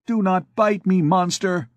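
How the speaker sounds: high, mildly voiced scared girl crying out; frightened cry. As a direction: push the monster off in fright. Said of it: "she cried".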